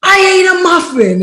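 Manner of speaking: high, loud acted-out confession; frantic high-pitched exclamation; hella loud. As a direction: shout it in retold panic. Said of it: "I was hella loud".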